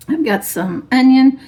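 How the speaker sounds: hoarse, voice giving out; strained, fading voice; losing voice. Real, not acted. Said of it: "I'm losing my voice".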